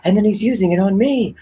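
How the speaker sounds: high, frantic mock plea; high-pitched hammed-up panic; panicked victim. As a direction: squeal it like a comic victim. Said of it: "they're like".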